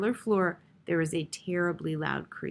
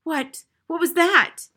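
high, complaining cry that gets louder; querulous, high-pitched alarm; querulous shout; 10 semitones higher, 8 dB louder, slower than usual. A narrator's reading. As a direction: demand it fretfully, building to a shout. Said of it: "a querulous shout from Missus Plummer".

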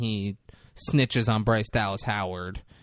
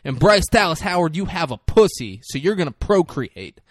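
louder, higher, emphatic comic rant; mock lecturing; 4 semitones higher, 6 dB louder than usual. Mother lecturing her sister on having kids, mock rant. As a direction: hammer it out like a preachy declaration.

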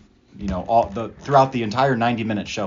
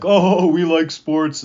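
high, loud, very slow mock jock voice; girls acting like boys; 7 semitones higher, 8 dB louder, much slower than usual. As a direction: ham up a tomboy bragging about sports. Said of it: "two girls acting like they were boys".

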